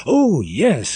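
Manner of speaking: put-on girls exclaiming. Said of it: "exclaimed all the young girls".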